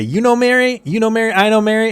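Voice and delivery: very high, rapid-fire squeaky disbelief; mock excited surprise. James hearing Laura knows Mary, high mock excitement. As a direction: babble it high, mock-amazed. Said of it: "And James is like".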